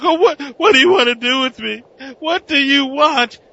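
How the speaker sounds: high, fast hammed-up fright; mock panic. Doctor questioning her captor, in high hurried mock fear. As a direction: babble in fake terror. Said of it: "And he's like".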